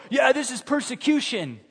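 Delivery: high, smug mock indignation; mock self-righteous.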